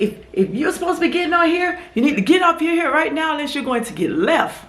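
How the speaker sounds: high, mock-bossy driver voice laughing at the start; imitating rude driver. Mocking the shuttle driver who threatened to leave passengers, high and bossy. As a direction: parody his curt warning. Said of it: "He said".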